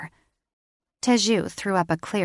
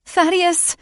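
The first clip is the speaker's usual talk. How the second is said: high, loud cry of a name; 11 semitones higher, 6 dB louder than usual. A narrator's reading. She cries his name as he runs into the fight. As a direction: call after him. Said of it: "she cried".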